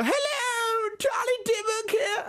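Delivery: falsetto squeak, very high and slow; a hopeless squeaky attempt at a woman; terrible Charlie Dimmock impression. Flatmate's awful prank call as the gardener, falsetto squeak. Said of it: "possibly the wor".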